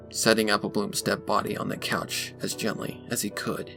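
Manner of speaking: plain reading voice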